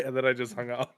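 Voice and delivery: ordinary delivery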